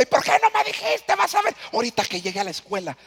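reclamo agudo y fuerte de padre furioso; regaña al hijo a gritos; padre enojado. Retold or imitated. Padre furioso por teléfono. (padre enojado regaña al hijo por teléfono)

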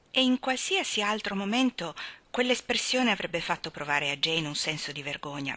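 plain reading voice

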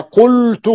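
قلت said high and loud with u, qultu; vowel demo. Shown as a demonstration. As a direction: model قلت loudly with u. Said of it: "قال".

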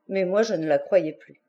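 plain reading voice

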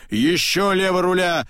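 high called order; shout. A narrator's reading. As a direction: shout the order with an edge of anger. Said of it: "кричит он". (крик со злостью в голосе)

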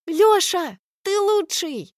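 high excited cheer; shouting. A narrator's reading. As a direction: cheer him on loudly. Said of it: "кричит Аля".

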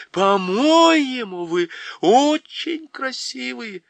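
high, slow and loud; emphatic girl. A narrator's reading. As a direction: insist over the din. Lucy shouting over the crowd. (настойчиво, высоко, медленно)

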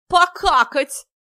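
high, loud, gleeful blurt; loud clownish outburst. A narrator's reading. Listless guest suddenly pipes up, high loud silly shout. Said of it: "подал вдруг голос доселе квёлый Дурилов".

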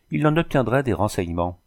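ordinary delivery

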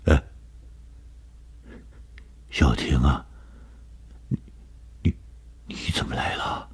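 soft, halting stammer; stunned. A narrator's reading. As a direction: falter into a near-whisper, caught out. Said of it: "显然被惊呆了". (惊呆了，低声结巴)

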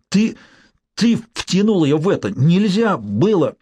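хриплое обвинение, медленно; выдави слова с ненавистью; яростный хрип. Acted. Умирающий брат обвиняет. (злой хрип умирающего)

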